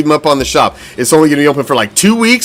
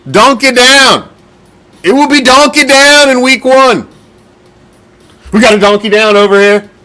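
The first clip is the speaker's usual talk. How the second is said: mock battlefield shout; 8 semitones higher, 4 dB louder than usual.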